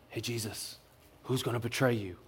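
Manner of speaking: very soft, low, confidential question; secretive whisper. Acted. John leans up to Jesus, asking in a secretive whisper. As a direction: lean in and ask it under your breath.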